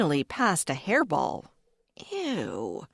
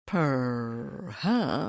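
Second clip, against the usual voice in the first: purring; 5 semitones lower than usual. Acted. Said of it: "The rumble of the R was a veritable purr".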